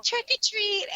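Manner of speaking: put-on high, singsong little girl voice; imitating the little girl. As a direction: mimic the girl's sweet trick-or-treat call. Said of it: "And she's like".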